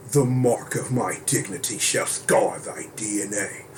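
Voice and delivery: slow, low villain declaration; a hint of a dark overlord, slow; booming demon lord. Villain quote voice.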